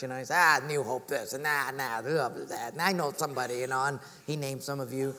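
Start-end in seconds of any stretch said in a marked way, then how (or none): 0.4-3.8 low, dismissive mock grumble; grumbling skeptic. Skeptic giving pastor a hard time about church, grumbling. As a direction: imitate a skeptic running the church down. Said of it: "he was giving me a hard time".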